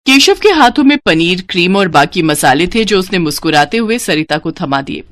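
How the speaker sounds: usual speaking voice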